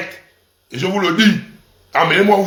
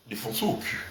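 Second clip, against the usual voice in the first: much softer and much lower, barely voiced; nearly whispered; 10 semitones lower, 16 dB quieter, faster than usual. Shown as a demonstration. Repeating it for his audience, a hushed low murmur. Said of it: "On va maintenant le défoncer au cul".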